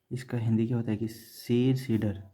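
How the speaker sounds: ordinary delivery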